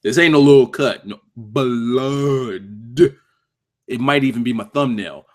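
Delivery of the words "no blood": "blood" stretched very long in two beats, buh-LOOD; emphatic. Real, not acted. Streamer correcting that it was no little cut, "blood" heavily drawn out. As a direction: drag out "blood" slowly for emphasis.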